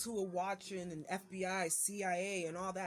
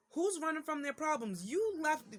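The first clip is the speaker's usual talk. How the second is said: higher and louder, indignant clapback; pitched-up outraged comeback; angry retort; 9 semitones higher, 6 dB louder than usual. Acted. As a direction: snap back at the accusation. Retort to ex's quoted text.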